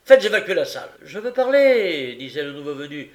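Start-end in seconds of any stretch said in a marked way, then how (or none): 0.0-0.9 loud, high command; irate magistrate's shout; shouted order. A narrator's reading. Magistrate shouts to clear court. 1.0-2.1 loud, high, drawn-out demand; defiant newcomer's shout; insistent cry. A narrator's reading. Newcomer insists on being heard.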